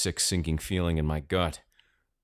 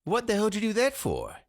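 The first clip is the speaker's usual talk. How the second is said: high, indignant outburst; a confused old man snapping at his grandson; irritated grandpa. A narrator's reading. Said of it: "confused and irritated".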